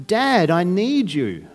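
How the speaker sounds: slow, sincere plea; earnest cry. Pretend. Believer crying out to God in suffering, slow and sincere. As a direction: lean on each word, a child's trust. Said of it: "We cry out".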